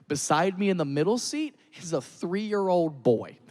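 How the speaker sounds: normal talking voice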